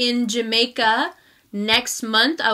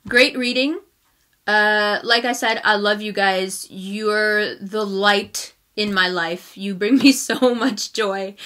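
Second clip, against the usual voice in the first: normal talking voice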